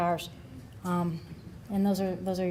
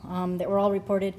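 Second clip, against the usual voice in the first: ordinary talk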